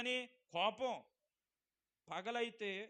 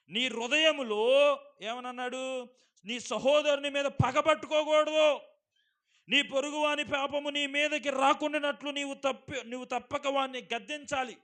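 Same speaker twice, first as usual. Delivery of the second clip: full force preaching; 8 semitones higher, 16 dB louder than usual. Acted. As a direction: thunder the verse at the congregation. (గట్టిగా నెమ్మదిగా ప్రసంగం)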